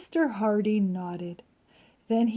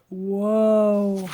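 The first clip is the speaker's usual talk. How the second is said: startled whoa; 6 dB louder than usual. Acted. Mr Hardy falls into hole.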